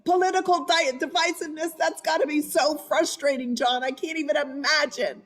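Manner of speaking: match the husband's worked-up energy, pitched high; mirroring his energy. Retold or imitated. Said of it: "I'd put up my arms like him".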